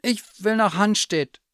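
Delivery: high, loud call to a driver. A narrator's reading. Calls to strangers in car.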